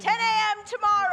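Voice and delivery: loud announcement voice; store announcement. Acted. Manager repeating announcement.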